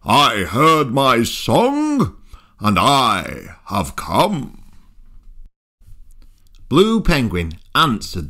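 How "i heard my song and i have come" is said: slow whale voice. Acted.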